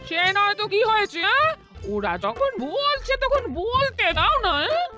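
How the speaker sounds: high-pitched indulgent pleading; coaxing delighted wife. Acted. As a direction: coax him in a soaring sing-song. Wife coaxes husband to enjoy it.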